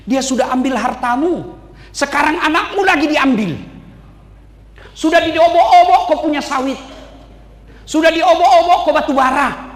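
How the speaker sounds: the devil yelling at Job to give up; ranting devil. Acted. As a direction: hammer Job with the losses. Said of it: "Apa, setan?".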